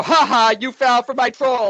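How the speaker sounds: high, loud mock gloat; gloating troll. Mocking a troll who gloats, high and loud. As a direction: crow over the trick like a smug troll. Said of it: "and I go".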